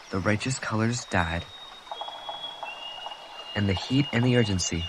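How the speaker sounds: plain reading voice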